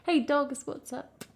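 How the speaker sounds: ordinary talk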